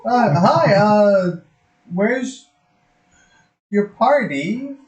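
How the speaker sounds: high, hesitant stammering creature; nervous turtle voice. Acted. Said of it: "the turtle's just like".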